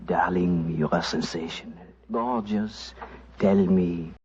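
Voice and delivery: put-on low, soft French-accented sweet talk; whispered French accent.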